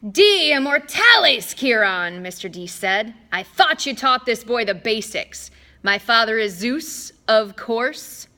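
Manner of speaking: loud, haughty god voice; scornful superior drawl; Mister D voice. A narrator's reading.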